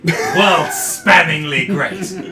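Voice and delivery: very slow, loud, laughing through words. Real, not acted. Over-the-top praise of a friend.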